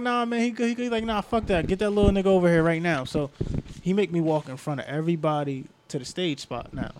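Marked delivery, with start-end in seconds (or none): 0.0-3.0 imitating the host. Marshall and wheelchair comic arguing.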